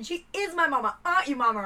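high insistent mock protest; tipsy girl insisting to her mate, high and laughing; insistent drunk girl. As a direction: defend it like a drunk child. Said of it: "And then she's going".

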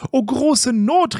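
high, drawn-out storybook lament; theatrical slow cry of alarm; crying out in dismay. A narrator's reading. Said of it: "rief die Blumenfrau".

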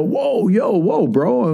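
high-pitched retold "hold on"; mock startled protest, pitched well up; acted-out taken aback. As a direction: rein in a friend, voice jumping up. Acting himself checking his friend's outburst.

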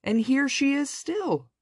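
very high, excited little bear; shrill baby bear delight; joyfully squeaking baby bear. A narrator's reading. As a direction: squeak it with glee like a bear cub. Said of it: "squeaked the baby bear joyfully".

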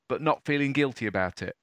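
normal talking voice

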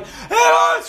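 put-on high, loud childish scream; screaming bloody murder. Daughter at the dentist, screaming bloody murder. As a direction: wail it like a kid in pain. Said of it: "Screaming bloody murder".

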